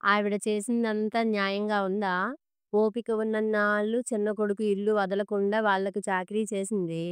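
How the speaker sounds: plain reading voice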